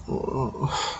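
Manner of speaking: soft sigh after a stumble; quiet, voiceless exhale. Real, not acted. Stumbles while reading, then apologizes.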